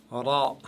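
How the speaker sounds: "Ra" called out full; heavy Arabic ra. Shown as a demonstration. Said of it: "toujours lourde".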